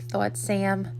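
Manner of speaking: usual speaking voice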